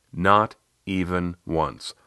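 "Not even once" slow, each word set apart; very distinct, emphatic. Retold or imitated. Coach quoting client's short sentence, "Not even once" very distinct and slow. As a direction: space out NOT, EVEN, ONCE as separate, deliberate words. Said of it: "you said three words very distinctly".

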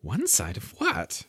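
a hint of a child puzzling it out; lightly voiced Alice. A narrator's reading. Alice puzzling to herself, lightly voiced. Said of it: "thought Alice to herself".

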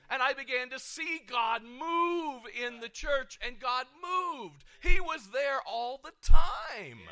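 fervent preacher's climax, slow and shouted; preaching at full force. Acted. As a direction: build the sermon to a loud, slow cry.

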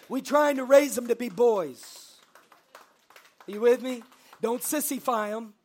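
shouted from the pulpit, high and loud; preaching shout. Real, not acted. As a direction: belt it out to the congregation. Calling the daddies for amen.

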